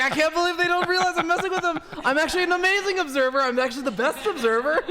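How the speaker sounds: high, loud, cocky mock voice of the player; mock smug boast. Caster mocking the player, high, loud and cocky. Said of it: "He was like".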